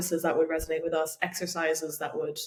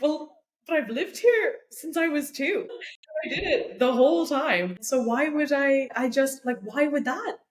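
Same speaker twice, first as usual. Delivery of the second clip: indignant retort; 6 semitones higher than usual. Retold or imitated. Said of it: "And I was like".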